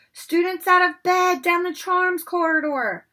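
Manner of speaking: slow, very loud and high; bellowed. Acted. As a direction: bellow it down the corridor. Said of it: "Peeves bellowed".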